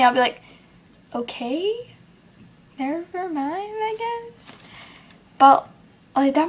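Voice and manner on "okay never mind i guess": soft, higher, rising trail; deflated giving up. Retold or imitated. Talker giving up when nobody listens, quiet deflation. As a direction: back down quietly, voice lifting at the end. Gives up after being talked over.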